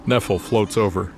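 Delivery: ordinary talk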